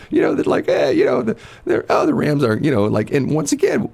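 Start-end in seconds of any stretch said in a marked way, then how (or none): 0.7-2.6 mock coach. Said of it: "he came right out and said it".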